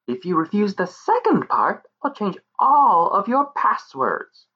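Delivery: high menacing fairy voice; sweetly threatening pixie. Acted.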